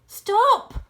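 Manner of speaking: loud, high barked command; sharp order. A narrator's reading. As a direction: stop them dead with one word. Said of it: "ordered Mister Fox".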